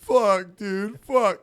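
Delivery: acted-out "I'm caught" cursing; dismayed cursing. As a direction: imitate a busted buddy's dismay. Buddy caught, retold cursing.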